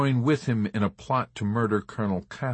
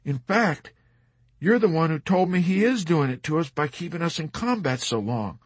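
higher-pitched, insistent retort; arguing; 8 semitones higher than usual. A narrator's reading. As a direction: press the point to persuade him. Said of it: "Dobbs argued".

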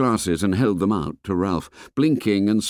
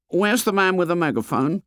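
put-on fat boy voice; 10 semitones higher than usual. A narrator's reading. Fat boy complains to the fair boy, high and whiny. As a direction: complain like a chubby schoolboy.

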